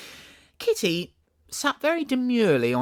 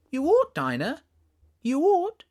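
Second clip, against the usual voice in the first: high, put-on crossness, rising on ought; mock cross voice; 5 dB louder than usual. Alice reproaching the old cat Dinah, childish mock-cross scolding. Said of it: "speaking in as cross a voice as she could manage".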